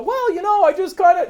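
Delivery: high, hesitant mock excuse; squeaky hedging imitation; mock waffling voice. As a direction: mimic a wishy-washy dodge. Mocking a waffling listener.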